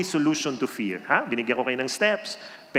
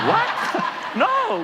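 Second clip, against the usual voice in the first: shocked no. Real, not acted. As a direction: burst out in playful disbelief. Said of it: "reacting to the bad advice".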